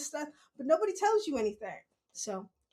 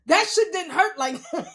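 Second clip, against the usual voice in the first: louder, laughing disbelief; 3 semitones higher, 7 dB louder than usual. Real, not acted. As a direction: say it amazed and amused. Laughing about the fall.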